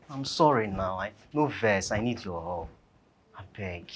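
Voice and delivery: low, soft apology; subdued pleading. Acted. Man apologising and begging her for help, subdued pleading. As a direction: beg quietly, keeping the voice small.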